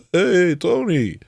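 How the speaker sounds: slow, high drawn-out "Hey, Tony"; friendly greeting. Retold or imitated. Inmates in the jail pod greeting a familiar face, stretched out. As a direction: greet him like an old buddy.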